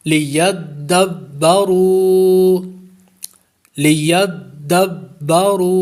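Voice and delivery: لیدبرو recited slowly with a firm doubled dal and ba; tajweed recitation. Shown as a demonstration. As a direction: press dal and ba firmly in لیدبرو. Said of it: "جماؤ کے ساتھ ادا کریں گے".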